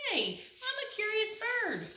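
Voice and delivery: a small bird excusing itself sweetly; curious duckling. Acted.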